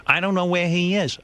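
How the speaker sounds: a hint of a fussing old mom; mother grumbling about the trainer, mildly mimicked; imitating his mother. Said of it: "My mother goes".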